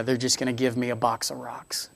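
unvoiced, low whisper of a worry; a fearful kid whispering to himself. Child voiced fearing parents' refusal.

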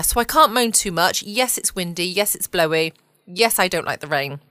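normal talking voice

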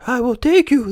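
very high, cooing bird voice; pigeon. A narrator's reading. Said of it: "the pigeon replied".

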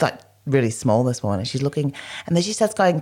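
ordinary talk, nothing marked

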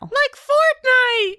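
put-on high, slow excited kid; drawn-out thrilled kid shout. Kid voice cheering.

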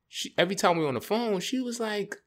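ordinary talk, nothing marked

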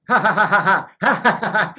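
loud, high, deliberate fake belly laugh; big staccato mock laugh; imitated forced laughter. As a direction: imitate a pastor forcing out laughter in bursts. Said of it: "then the pastor starts going".

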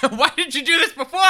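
high, loud laughing protest; hammed-up indignant outburst; mock outrage. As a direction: exclaim it in comic disbelief, half laughing. Acted outraged reply to boyfriend.

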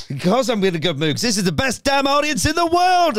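high, rising crowd-pleasing shout; giddy Norm Macdonald. Retold or imitated. Comic flattering a talk-show audience, giddy. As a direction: hype the audience, giddily overdone. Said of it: "he's obviously in a giddy mood".

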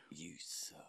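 whispered tease, no voice. Real, not acted. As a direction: breathe it out as a jab. Teasing aside to co-host.

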